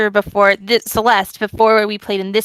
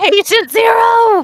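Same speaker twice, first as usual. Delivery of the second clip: high, slow, loud dramatic declaration; dramatic shout; 13 semitones higher, 7 dB louder, slower than usual. Pretend. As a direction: announce it like a movie trailer. Joking about fungal infection.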